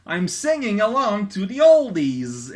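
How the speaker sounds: sing-song comic line; hammed-up comic hero; mock cheerful character. Reading comic speech bubble.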